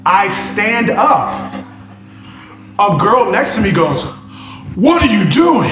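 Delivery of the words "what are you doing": raised, scandalized question; retold indignant neighbor, louder and higher; startled moviegoer. Girl beside him questioning the man standing up, indignant.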